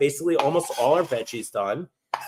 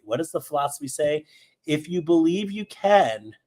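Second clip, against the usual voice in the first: normal talking voice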